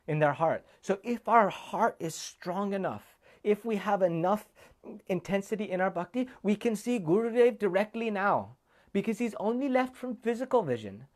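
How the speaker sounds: rising devotional fervour; impassioned preaching. Acted. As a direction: preach it to move hearts.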